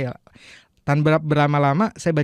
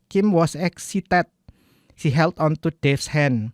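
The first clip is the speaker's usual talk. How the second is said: English read with a heavy Indonesian accent; membaca tanpa acuan; 3 semitones higher, slower than usual. Shown as a demonstration. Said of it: "tanpa saya ada acuan gitu". (logat Indonesia, membaca tanpa acuan)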